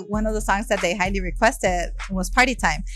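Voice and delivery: ordinary talk, nothing marked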